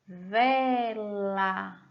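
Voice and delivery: "vela" in two long syllables; slow dictation of "vela", syllables held. Shown as a demonstration. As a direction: stretch "vela" slowly as ve, la. Teacher sounding out changed word.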